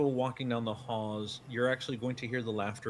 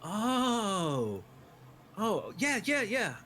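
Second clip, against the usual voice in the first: high, quick, flustered yeahs; flustered surprise; 9 semitones higher, slower than usual. Acted. Mabel, suddenly flustered, leading the group onward. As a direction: caught off guard, then hurry them along.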